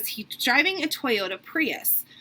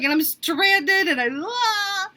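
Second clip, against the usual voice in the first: high, drawn-out comic wailing; mock ugly crying; 7 semitones higher, much slower than usual. Stranded traveler telling a taxi driver, mock bawling. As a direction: blubber it out, dissolving into a hammed-up wail. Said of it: "my crying was so ridiculous".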